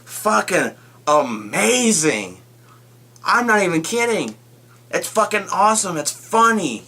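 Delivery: raving. Real, not acted. Raving about the scene.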